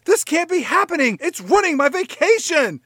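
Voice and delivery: very high, loud mock whine; whiny Sandra Bullock. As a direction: whine like a spoiled tourist. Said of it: "Sandra Bullock says".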